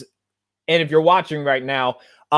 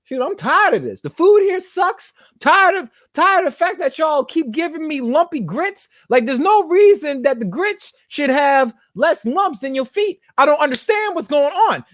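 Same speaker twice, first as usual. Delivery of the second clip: high-pitched, fed-up comic rant; mock complaining; 9 semitones higher than usual. Himself as a defiant slave griping about food, mock indignant. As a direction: gripe indignantly, pitched well above normal.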